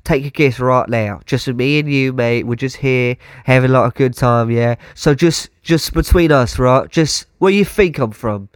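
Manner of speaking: chummy put-on Cockney, egging someone on; fake Cockney accent. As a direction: play the Cockney local, coaxing a guess. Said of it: "Cockney one down".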